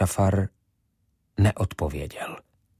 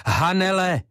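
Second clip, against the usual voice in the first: much higher, ringing call; 11 semitones higher, 3 dB louder than usual. A narrator's reading. Ivo calling Hanele again, higher and louder. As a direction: call her name louder still.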